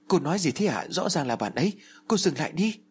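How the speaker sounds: frantic shout. Acted. Girl begs the medium to stop cutting, frantic shout. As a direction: yell at her to stop. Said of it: "Tôi vẫn hét lên".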